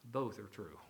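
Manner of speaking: low, soft "both are true"; understated conclusion. Retold or imitated. Quoted conclusion, first take.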